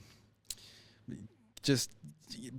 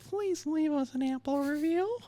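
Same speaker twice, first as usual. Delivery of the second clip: high, slow, begging squeak; high pleading voice; slower than usual. Acted. Host begs listeners for an Apple review, high slow squeak. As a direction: beg for the review in a tiny high voice. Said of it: "You don't have to say it like that".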